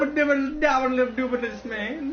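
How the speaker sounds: high Cosby scat; Bill Cosby impression. Joining the Cosby scat, up high.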